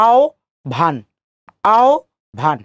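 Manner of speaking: আও ... ভান split as "ao", pause, "bhan"; syllable-by-syllable pronunciation. Shown as a demonstration. Teacher applying the o-and-bh rule, syllable-by-syllable pronunciation. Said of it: "হয় বর জায়গায় আমরা কি বসাবো?".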